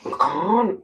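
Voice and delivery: words through a numb burning mouth. Real, not acted. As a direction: talk like your lips are numb. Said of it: "I can't feel my mouth".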